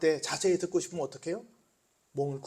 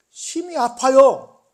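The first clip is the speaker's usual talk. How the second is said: slow, loud and higher, dragging each syllable; anguished emphasis; 8 semitones higher, 12 dB louder, much slower than usual. Acted. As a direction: lean hard into the pain, very slowly. 설교 중 고통을 강조. (아주 느리고 크게 아픔을 강조)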